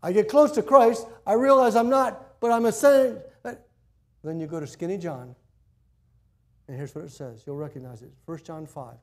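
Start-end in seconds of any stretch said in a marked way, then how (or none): 0.0-3.2 loud, high pulpit preaching; full-force preaching. Acted. Pastor preaching loudly to the congregation about sin. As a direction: preach it out to the whole room.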